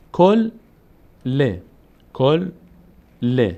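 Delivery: "کل" held on l, pause, then short "ل" with its vowel; doubled letter, split. Shown as a demonstration. Teacher shows learners l read twice, syllables apart. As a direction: sound out "کل", stop, "ل", twice over.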